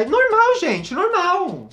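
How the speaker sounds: high, drawn-out mock reassurance; falsete brincalhão. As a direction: insist in a playful high pitch. Defende em tom brincalhão ficar na escada. (falsete arrastado, de brincadeira)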